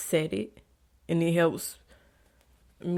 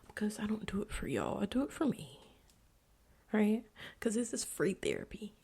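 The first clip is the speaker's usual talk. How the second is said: hushed confession; 2 semitones higher, 8 dB quieter than usual. Real, not acted. Confessing why she podcasts.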